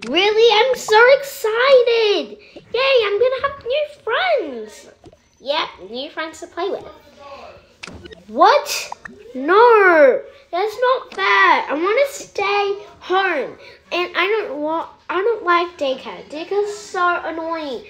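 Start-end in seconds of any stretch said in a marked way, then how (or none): 0.1-4.9 high, slow squeal of joy; excited kid. Acted. Child thrilled about daycare and new friends, high squeal. 8.3-17.8 whiny protest. Acted. Caleb protests going to daycare.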